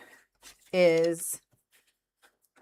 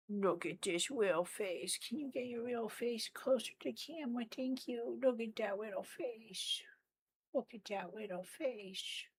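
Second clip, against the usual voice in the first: cooing at a pet; 3 semitones higher, 13 dB quieter than usual. Real, not acted. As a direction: coo softly at a cute little cat. Cooing at her cat.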